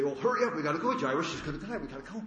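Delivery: high, loud urgent rush that fades; mock hurrying Jairus along; impatient hurry. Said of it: "The Lord didn't say".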